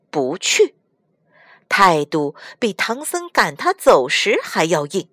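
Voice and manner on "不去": Monkey King's flat refusal. Acted. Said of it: "孙悟空就是一句".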